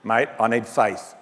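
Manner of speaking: put-on Australian accent. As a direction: play the Lord as a laid-back Australian bloke. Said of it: "It's the Australian Bible".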